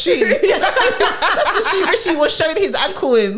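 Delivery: high laughing outburst; laughing loudly. Real, not acted. Joking about the PM's trainers, laughing. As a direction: crack up while saying it. Friends cracking up at joke.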